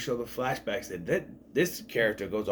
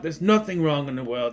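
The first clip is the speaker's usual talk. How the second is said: ordinary talk, nothing marked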